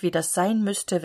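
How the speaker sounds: ordinary delivery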